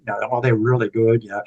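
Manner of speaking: imitating the waitress's American accent, lightly; a mild put-on American accent. Montana saloon waitress praising her pasties, mild mock American accent. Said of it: "she told me in an American accent".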